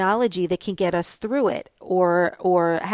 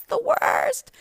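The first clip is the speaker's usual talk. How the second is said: high exasperated. Real, not acted. Exasperated outburst about calm down.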